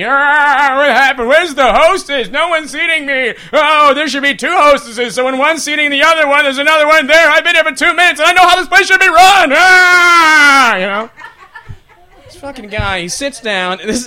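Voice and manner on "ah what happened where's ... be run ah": high, loud hammed-up whining; mock outraged old-guy griping; complaining old man. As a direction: rant like a cranky old customer, building to a yell. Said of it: "complaining about everything".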